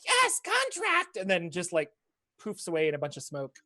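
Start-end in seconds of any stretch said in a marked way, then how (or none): 0.1-1.2 very high, slow, gleeful imp squeal. Acted. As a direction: stretch it out like a delighted imp. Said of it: "and he's like".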